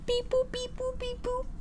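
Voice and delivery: high, soft sing-song beep boop; robot beeps. Pretend. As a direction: beep like a cute little robot. Playful robot beeps introducing item.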